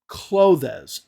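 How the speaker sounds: clothes as "KLOH-thez"; clothes stretched into two syllables; mispronounced clothes. Shown as a demonstration. Teacher showing the learner's error, clothes as "kloh-thez". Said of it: "if you say the word".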